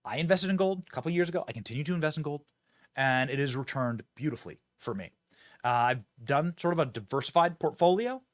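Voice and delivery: brisk sponsor read with a fast pitch for the phone number. Acted. Host reading a gold sponsor spot to viewers, upbeat ad read.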